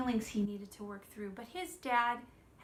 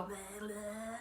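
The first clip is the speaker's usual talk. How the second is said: silly voice; 5 dB quieter than usual. Shown as a demonstration. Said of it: "Do you go".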